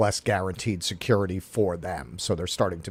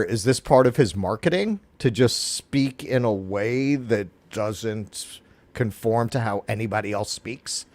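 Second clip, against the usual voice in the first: ordinary talk, nothing marked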